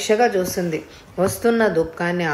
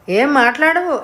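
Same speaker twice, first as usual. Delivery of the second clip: higher, louder snapped demand; yelled question; 7 semitones higher, 4 dB louder than usual. A narrator's reading. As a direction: shout at the silent boy. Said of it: "అంటూ కేకేసింది". (కేకేసి అడగడం)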